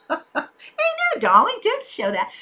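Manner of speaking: badly faked British accent, laughing. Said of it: "that's my worst [name] accent ever".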